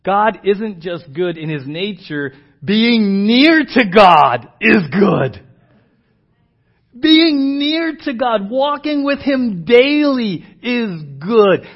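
slow, loud, weighted words on near and good; slow emphasis. Acted. Pastor pressing God's nearness on his church, slow and loud. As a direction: hammer it home word by word. Pastor stressing the key point.

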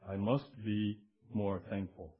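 low, slow, soft piety; ponderous mock-earnest recital; mock solemn resolve. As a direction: drone it like a dutiful churchgoer. Mocking overthinking in church.

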